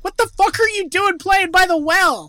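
put-on loud, high furious yell at the kid; angry rich dad. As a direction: scold him at full blast. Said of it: "was Thomas Wayne being like".

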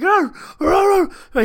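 high, loud frustrated dog cries; loud, high-pitched mock barking; imitated puppy noises. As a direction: yelp like a worked-up puppy.

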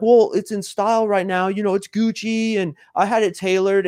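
high-pitched, over-explaining justification; defensive explaining. Acted. Man justifying his clothes to a woman, defensive. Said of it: "they try to explain themselves".

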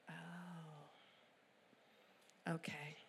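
stunned hush. Retold or imitated. Stunned by dad's confession.

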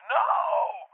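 very high, drawn-out "no"; mock alarmed cry. Dreamer answers pilot as birds race at him, mock alarmed cry.

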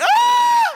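high, loud startled yelp. Retold or imitated. Preacher meeting his wife's father, a high loud startled yelp. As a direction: jump back with a sharp yelp.

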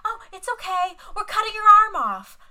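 breezy, high, loud imitation of a calm nurse; imitating a too-casual reassurance; mock casual. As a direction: toss it off like it's nothing. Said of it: "So fucking casual".

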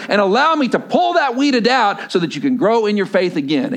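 loud, high, driving sermon voice; preaching at full force. Acted. Pastor pressing his congregation, preaching loud and high. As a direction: preach it hard, building to a peak.

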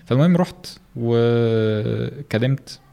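ordinary talk

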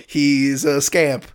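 ordinary talk, nothing marked

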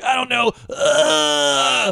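high, whiny cry of despair; mock exasperated wail. Stabbed man on the radio begging, mock crying wail. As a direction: wail it like a helpless victim. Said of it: "He's like".

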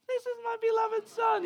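huge, high-pitched shouted God voice; booming voice of God. Pretend. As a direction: boom it out like God from heaven. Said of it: "It had to be booming".

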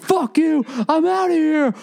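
voix très aiguë, cri moqueur; mock scream. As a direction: imiter Mike qui hurle, bien plus aigu. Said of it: "like, screaming".

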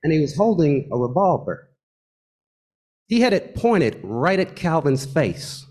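usual speaking voice

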